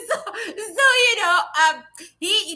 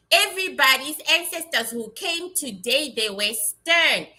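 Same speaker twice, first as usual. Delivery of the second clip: normal talking voice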